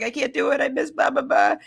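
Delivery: whiny refusal. Retold or imitated. Retold self backing out.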